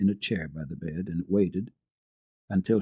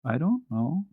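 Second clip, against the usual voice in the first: higher, small scared reply; lightly voiced frightened girl; 7 semitones higher than usual. A narrator's reading. Heidi frightened before the gentlemen, small voice. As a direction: answer like a scared child. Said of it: "scarcely able to make a sound".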